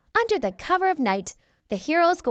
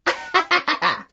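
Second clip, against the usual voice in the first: higher, gloating laugh at the heroes; mocking villain laugh; 5 semitones higher than usual. A narrator's reading. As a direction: laugh at them like a smug villain. Romeo mocks squabbling heroes.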